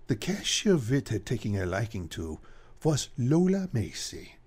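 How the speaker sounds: ordinary talk, nothing marked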